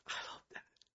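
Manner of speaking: soft breathy whisper to himself; a private whispered I love that; whispered aside. Real, not acted. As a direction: breathe it almost silently. Whispered aside about scripture.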